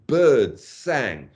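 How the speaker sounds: slow equal weight on "birds" and "sang"; two long falling beats, "birds sang"; stressed line ending. Shown as a demonstration. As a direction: stretch both "birds" and "sang". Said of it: "the last three syllables ... are also stressed".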